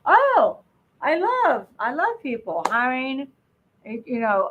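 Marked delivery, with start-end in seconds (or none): 0.0-3.3 high, gushing mock enthusiasm; hammed-up eagerness, pitched up; naive enthusiast. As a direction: gush like a clueless optimist. Voices a naive entrepreneur's misconception.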